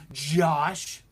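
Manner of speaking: ordinary delivery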